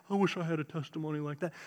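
low, quick, quiet grumble; soft complaining. Retold or imitated. Preacher imitating his freshman self complaining to himself, softly wistful. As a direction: mumble a wistful complaint to yourself. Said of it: "he'd heard me complaining".

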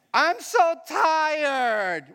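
long drawn-out, high-pitched whine; whining child. Acted. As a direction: drag it out like a worn-out kid. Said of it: "Who has kids?".

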